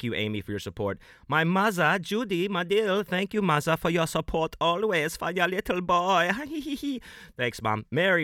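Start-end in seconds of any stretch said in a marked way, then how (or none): 1.3-6.3 high, hammed-up son thanking mother; mock little-boy voice. Put-on child voice thanking his mother. 6.4-7.1 high-pitched tee-hee giggle; squeaky put-on titter; silly high giggle.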